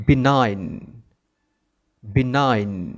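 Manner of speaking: "benign" said bi-NAIN; silent G. Shown as a demonstration. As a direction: leave the g out of "benign". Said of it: "সেটার সঠিক উচ্চারণ হবে".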